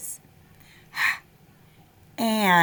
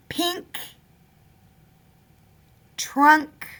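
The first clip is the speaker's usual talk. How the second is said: ordinary delivery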